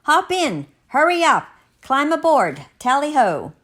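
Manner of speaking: high, loud, rousing shouts; hurried boarding call. Acted. Mr. Matu urging Finn into the balloon, rousing call. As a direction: call them aboard with gusto.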